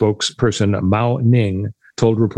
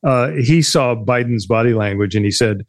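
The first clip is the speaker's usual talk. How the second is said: ordinary talk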